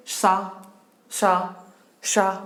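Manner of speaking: "शा," with warm air pushed out of the mouth; isolated "शा," with an audible rush of breath; warm-air sha. Shown as a demonstration. Teacher having students feel warm air, breath-driven "शा,". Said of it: "गरम गरम हवा निकलती है".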